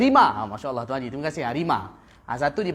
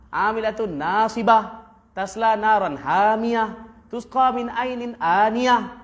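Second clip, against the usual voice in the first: slow, melodic tajwid chant; Quran recitation; 4 semitones higher, slower than usual. Acted. Ustaz recites the surah to his class, slow melodic chant. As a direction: recite the verses with long, sung vowels.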